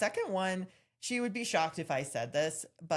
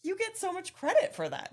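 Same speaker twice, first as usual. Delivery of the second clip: high-pitched, emphatic gush; retold excited praise, pitched way up; 4 dB louder than usual. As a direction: hand over the credit in a squeal. Gushing reply to proud friend.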